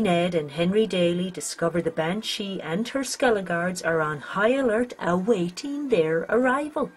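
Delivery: usual speaking voice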